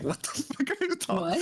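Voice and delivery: high-pitched mock-incredulous question; retold disbelief. Streamer retelling their reaction to accent compliments, mock incredulous. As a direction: act out a baffled reaction, voice jumping high.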